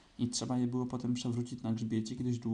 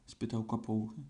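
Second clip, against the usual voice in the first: ordinary delivery, nothing marked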